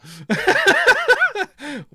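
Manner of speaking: high, loud cackle; helpless high-pitched laughing; loud laughter. Real, not acted. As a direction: crack up over something crude. Laughing at the crude song.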